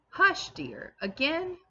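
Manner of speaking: pleading reproach, pitched high; remonstrating. A narrator's reading. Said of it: "Lucy remonstrated".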